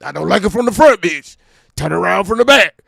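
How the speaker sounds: loud barking rapper demand. Retold or imitated. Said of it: "Can you imagine D M X".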